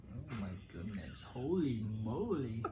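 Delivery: ordinary talk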